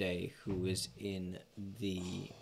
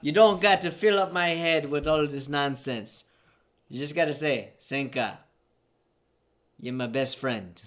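loud, raised Jamaican character voice; Jamaican accent; 5 semitones higher, 8 dB louder than usual. Acted. Teammate tells Sanka what to say, Jamaican accent. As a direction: protest in a Jamaican accent, easing at the end. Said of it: "it's so hard to do that fucking accent and that character".

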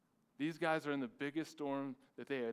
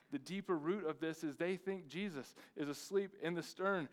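ordinary talk

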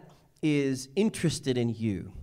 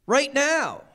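higher and louder, punch out right now; climactic shout; 12 semitones higher, 6 dB louder than usual. Real, not acted. Preacher to congregation, rising to a ringing shout. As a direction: hit the peak of the line with full force.

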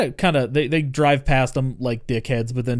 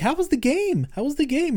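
much higher eager mom voice; imitating mom; 13 semitones higher than usual. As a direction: chirp it like a hovering mother. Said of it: "and Mom's like".

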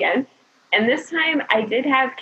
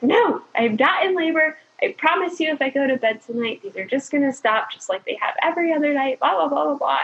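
higher-pitched, insistent retort; testy protest tailing into dismissive blahs; acted-out touchy denial; irritated denial; 5 semitones higher than usual. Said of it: "my mood was changing by that point".

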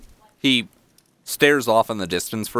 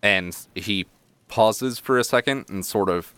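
ordinary talk, nothing marked

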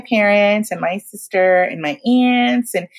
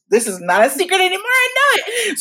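giddy disbelief; 13 semitones higher than usual. Retold or imitated. Realising the secret's out, moved.

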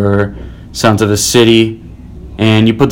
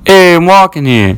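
New Yorker yelling; 7 semitones higher than usual. Acted. City scene, annoyed pedestrian.

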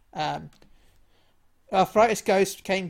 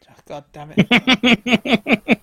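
loud, drawn-out groan of frustration; frustrated outburst; 5 semitones higher, 11 dB louder, much slower than usual. Real, not acted. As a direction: curse it slowly and loudly. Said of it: "frustrated at spelling".

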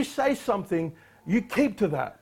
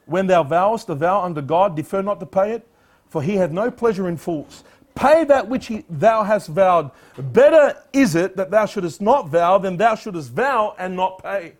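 louder, solemn declaiming; scripture reading; 5 dB louder than usual. Acted. Preacher quoting Solomon to congregation, declaiming. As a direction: read it as holy writ. Reads Ecclesiastes aloud.

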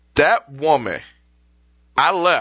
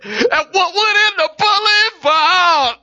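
gleeful mock wail; 12 semitones higher, 3 dB louder, slower than usual. Imagined doctor cheering.

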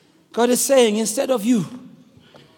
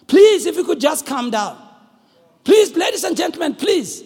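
raised-pitch appeal for calm; pleading captain; 7 semitones higher than usual. Acted. Captain addressing screaming passengers through the speakers, pleading. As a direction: plead with a panicking cabin.

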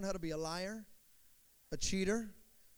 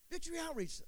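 high, surprised echo of "Victory Outreach?"; a lightly voiced old man, caught off guard; imitating surprise; 9 semitones higher than usual. Old veteran echoing the church name in mild surprise. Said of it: "He goes".

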